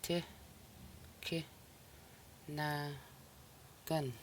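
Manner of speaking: Ti, ki, na, gan, each syllable set apart by a pause. Shown as a demonstration. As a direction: break the word into its four syllables. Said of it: "So here there is four syllables".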